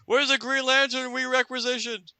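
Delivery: high, put-on banker voice; banker's voice. Comic book reader voicing a banker. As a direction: play a panicked banker. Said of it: "it's like".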